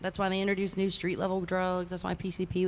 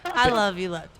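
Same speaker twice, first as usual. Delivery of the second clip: excited affection; 7 dB louder than usual. Real, not acted. Affectionate greeting.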